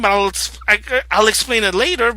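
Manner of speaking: ordinary talk, nothing marked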